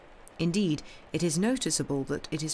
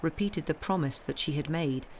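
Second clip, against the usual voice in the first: plain reading voice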